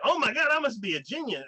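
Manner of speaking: high, loud self-amazed exclamation; smug delight. Retold or imitated. Hero thinking he understands them, gushing with smug delight. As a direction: gush it up high, pleased with himself.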